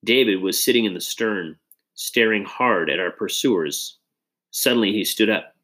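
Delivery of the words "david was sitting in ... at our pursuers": ordinary delivery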